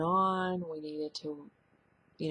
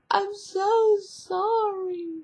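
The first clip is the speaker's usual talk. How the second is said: very high, louder, slow tearful wail; imitating crying daughter; 9 dB louder, much slower than usual. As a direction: drag out her sobbing apology, pitched way up. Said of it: "and she was crying".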